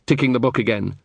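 ordinary delivery

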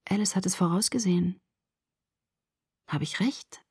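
softly voiced, tentative realization; quiet, careful conjecture; hushed guess. A narrator's reading. Narrator voices a cautious guess.

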